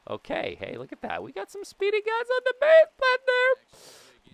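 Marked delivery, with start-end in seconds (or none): 1.2-4.3 high, loud excited yelp; excited call. Real, not acted. Player hyping the speedy runners, excited and high. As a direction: pitch it way up in a loud burst. Spots fast runners on base.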